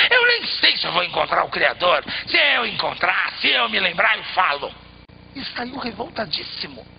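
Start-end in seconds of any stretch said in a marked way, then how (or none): 0.1-4.7 indignant man. A narrator's reading. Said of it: "E o homem revoltado".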